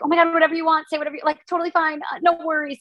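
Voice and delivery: high, sweet, eager to please; high bubbly pushover voice; sweet people-pleaser voice. Retold or imitated. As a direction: chirp it out brightly like you'd agree to anything. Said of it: "that's me as a person".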